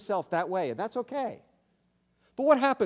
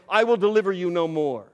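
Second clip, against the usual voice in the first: louder, driving the words home; loud emphatic declaration; 3 semitones higher, 9 dB louder than usual. Acted. Preacher thundering God's warning to the congregation, loud. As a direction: proclaim it like a thunderous verdict.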